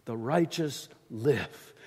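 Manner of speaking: The righteous live, slow, with live weighted and held; slow down and lean into live; stress on live. Shown as a demonstration. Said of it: "Why not emphasize that middle bit?".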